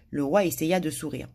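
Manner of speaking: plain reading voice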